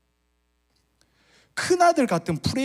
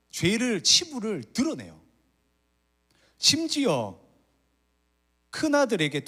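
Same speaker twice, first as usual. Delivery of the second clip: much higher, rising preacher's climax; impassioned preaching; 9 semitones higher than usual. Acted. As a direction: preach it with building fervour. Preacher climaxes on the elder son. (높은 음으로 열정적인 설교)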